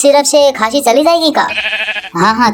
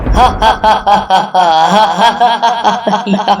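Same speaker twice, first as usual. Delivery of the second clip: big hammed-up laugh; loud open laugh. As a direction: laugh with the mouth wide open. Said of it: "बार बार मुंह फाड़ के हंसी रहा है".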